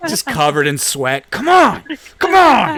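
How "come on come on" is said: shrill frantic yell, much higher; desperate kid yelling at the game. Retold or imitated. Mock frantic gamer yelling.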